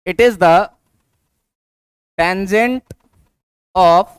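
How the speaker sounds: one or two words at a time, long gaps; slow dictation. Shown as a demonstration. Teacher telling students what slope is, slow dictation. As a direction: dictate piece by piece, leaving time to write.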